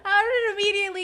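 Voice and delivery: high squeaky words through laughter; cry-laugh the question. Real, not acted. Car wedged, helpless laughter.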